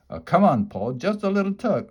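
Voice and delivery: pushy little pigtail voice; urging pigtail. A narrator's reading. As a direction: wheedle him into it. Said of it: "urged the pigtail".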